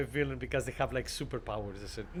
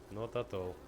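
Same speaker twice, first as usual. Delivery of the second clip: ordinary talk, nothing marked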